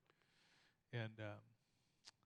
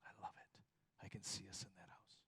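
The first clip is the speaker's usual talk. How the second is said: whispered, longing; wife's dreamy whisper; 3 semitones lower, 9 dB quieter than usual. Retold or imitated. Wife whispering to husband about the house, whispered. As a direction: breathe it like she is already moving in. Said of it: "she says".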